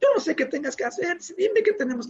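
imitating her crying, high and fast; tearful daughter. Daughter begging dad to find a way, imitated crying. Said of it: "pero llorando así".

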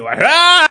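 loud, high monster roar; orc-like yell. Retold or imitated. Woman bursting into a bar, orc-like yell. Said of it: "como si fuera un orco de Mordor".

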